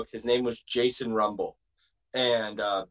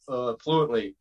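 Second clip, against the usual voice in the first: normal talking voice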